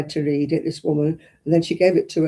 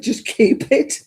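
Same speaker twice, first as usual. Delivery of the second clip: laughing while quoting; 6 semitones higher, much slower than usual. Retold or imitated. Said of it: "He said".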